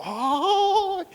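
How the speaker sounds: long, high-rising "ay"; hammed-up high squeal of excitement; mock thrilled gasp. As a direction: imitate a congregation swooning with delight. Mimics congregation thrilled by prophecy.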